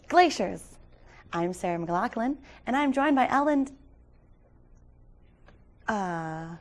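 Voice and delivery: bright telethon host. Pretend. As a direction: welcome viewers back to telefund. Hosts the telefund.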